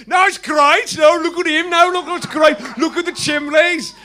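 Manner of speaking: high, loud, full Brummie shout. Retold or imitated. Brummie rocker praising the chimneys, high and loud. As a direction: belt it like a glam rock Brummie frontman. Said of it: "first you go full Brummie with Slade, [name]".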